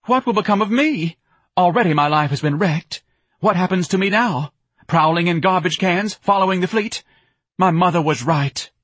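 high, loud self-pitying lament; distraught wailing. A narrator's reading. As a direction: wail over a wrecked life. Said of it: "sobbing something about going out".

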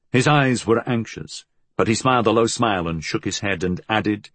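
plain reading voice

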